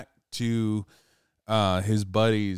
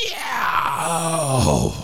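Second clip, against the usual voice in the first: loud, high falling yowl; creature's scream; 7 semitones higher, 5 dB louder than usual. Retold or imitated. Heard by the campers, loud howling shriek. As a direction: imitate a screech from the dark. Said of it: "And all they hear".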